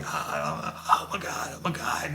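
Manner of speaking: hushed, airy mock gasping plea; imitated panic. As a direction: act out a man overcome by pepper spray, softly. Imitating man as the spray hurts.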